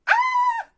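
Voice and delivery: high, loud fright scream; a sudden shriek of fright; little bear's shrill startled cry. Acted. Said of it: "hurle le petit ours".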